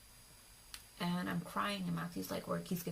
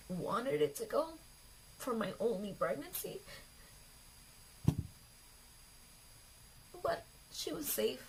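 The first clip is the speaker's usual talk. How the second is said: higher voice, holding back tears; tearful; 4 semitones higher than usual. Real, not acted. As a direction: struggle through it, close to crying. Grieving the birth she wanted.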